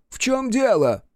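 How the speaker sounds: very high, exclaimed question; high-pitched, exasperated query; indignant demand. Acted. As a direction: demand an answer, pitch leaping up. Demanding cause of the fight.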